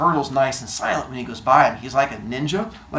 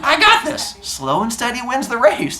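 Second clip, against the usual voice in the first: high-pitched, chirpy self-assurance; cocky runner; 8 semitones higher than usual. Acted. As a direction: boast it in a high mock voice. Acting out overconfident slow runner.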